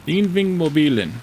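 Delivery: Innvingmobilen said as innving-mobilen, copying the recording, falling at the end; copying Norwegian pronunciation. Shown as a demonstration. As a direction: echo the Norwegian Innvingmobilen carefully. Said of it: "I didn't do too bad after I struggled through it".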